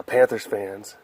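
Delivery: normal talking voice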